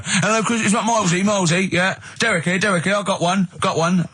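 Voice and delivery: hammed-up radio phone-in punter; eager phone-in caller. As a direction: play a hapless listener thrilled to get through. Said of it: "I wanted to get through and go".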